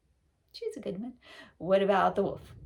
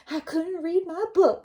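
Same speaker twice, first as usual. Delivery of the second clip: granny voice; 10 semitones higher, 6 dB louder than usual. Acted. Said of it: "said Granny".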